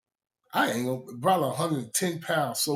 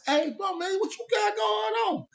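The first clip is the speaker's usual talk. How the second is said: high, shouted question at the roommate; excited call-out. Retold or imitated. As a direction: call out, demanding to know. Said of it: "I said".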